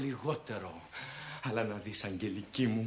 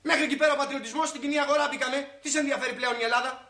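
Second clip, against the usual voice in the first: scoffing; 12 semitones higher, much faster than usual. Acted. Husband scoffing at wife's patriotism.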